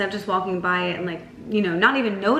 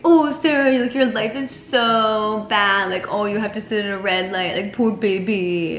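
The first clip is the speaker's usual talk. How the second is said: mocking self-pity; 4 dB louder than usual. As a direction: tease yourself out of a bad mood. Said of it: "I'll literally say, like, things like that to myself".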